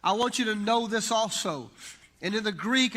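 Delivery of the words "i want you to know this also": louder, raised-pitch insistence; emphatic. Retold or imitated. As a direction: press the point home firmly. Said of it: "it's a very emphatic".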